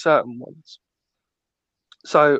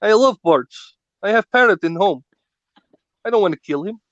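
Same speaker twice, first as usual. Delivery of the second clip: indignant mock Russian-Austrian accent, pitched up; Arnold Schwarzenegger; 4 semitones higher, 3 dB louder than usual. Bird lover horrified by the idiom, indignant mock Russian-Austrian accent. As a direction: protest in a thick put-on foreign accent. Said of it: "Arnold, Arnold's going".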